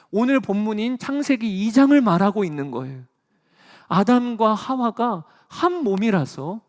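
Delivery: fervent preaching. Acted. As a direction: preach it with rising fervour.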